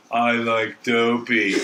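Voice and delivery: slightly put-on slow dope; slow mock imitation of a dummy; imitated dimwit. Host mimicking the person handed the phone, slow. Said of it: "that dumbass fucking hand in the phone".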